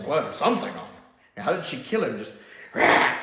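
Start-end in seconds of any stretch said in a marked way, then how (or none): 2.7-3.2 short, loud snarling "rah"; mock werewolf roar. Imitates the werewolf's attack.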